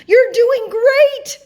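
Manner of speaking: high, loud, stretched-out cheer; encouraging cheer. A narrator's reading. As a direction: cheer them on from far away. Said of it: "encouraged the moon".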